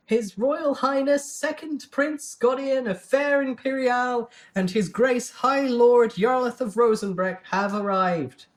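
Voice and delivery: high, slow, loud court announcement; formal herald announcement. A narrator's reading. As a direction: announce the royals to the whole court. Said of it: "the speaker to announce their entrance".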